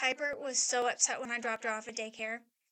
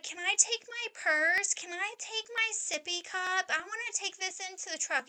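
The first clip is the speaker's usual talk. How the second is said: put-on whining child; 7 semitones higher than usual. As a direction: imitate a little girl whining for her things. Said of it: "she's, like, whining as she says it".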